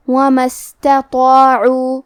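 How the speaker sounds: "اسْتَطَاعُوا" with light ta, then heavy, full ta and alif; heavy tafkhim. Shown as a demonstration. Teacher demonstrates heavy letters, slow chanted "وَمَا اسْتَطَاعُوا". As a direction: chant "وَمَا اسْتَطَاعُوا" slowly, thickening the emphatic syllable. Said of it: "دونوں کو تفخیم سے مطلب بھاری آواز".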